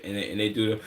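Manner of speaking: usual speaking voice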